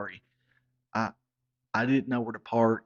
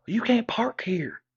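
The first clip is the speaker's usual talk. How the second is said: high, loud panicked plea dropping to a whisper; she's horrified he parked on the lawn; panicked girlfriend; 4 semitones higher, 4 dB louder than usual. Retold or imitated. Girlfriend panics as her boyfriend parks on her parents' lawn, high frantic plea. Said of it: "and Sheila said".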